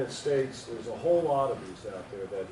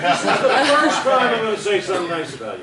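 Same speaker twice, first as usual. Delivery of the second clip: laughing; 9 semitones higher, 11 dB louder than usual. Real, not acted. Teasing lead-in to praise.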